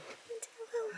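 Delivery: high, soft cooing question; whisper-coo it to the cat; hushed pet talk. Real, not acted. Softer repeat to cat.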